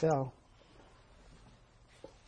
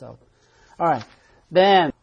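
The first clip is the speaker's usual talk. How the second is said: normal talking voice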